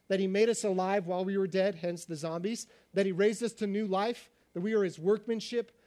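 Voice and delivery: ordinary talk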